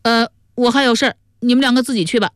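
high-pitched character voice; 于宝宝. A narrator's reading. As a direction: voice the character high, well above the narration. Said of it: "于宝宝说".

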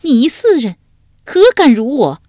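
high, loud outraged scolding; furious rebuke. A narrator's reading. Ai Jiang answers Shu Diao's insult, in a furious rebuke. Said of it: "哀姜闻言大怒， 拍案斥道".

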